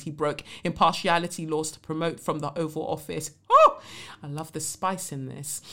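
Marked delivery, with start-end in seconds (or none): 3.5-3.8 short, loud, very high "oh"; high squeal of delighted shock; relishing oh. Real, not acted. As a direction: let out a quick high whoop at a juicy jab. Relishing the letter's spice.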